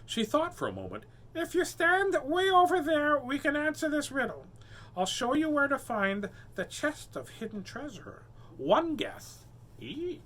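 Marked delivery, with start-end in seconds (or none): 1.3-10.2 mermaid voice. Acted. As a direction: speak up high and sweet, keeping distance. Mermaid speaks to pirate.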